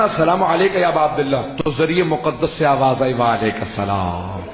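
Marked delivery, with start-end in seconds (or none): 3.1-4.4 much lower, slow, soft answering voice; hushed, reverent reply from the tomb; gentle reply. Acted. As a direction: answer softly from the shrine. Voice replying from the shrine.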